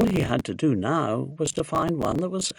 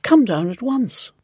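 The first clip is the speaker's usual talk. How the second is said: high, raised-voice invitation; eager cry; 8 semitones higher, 6 dB louder than usual. A narrator's reading. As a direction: call the neighbour down eagerly. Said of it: "Missus Silver cried".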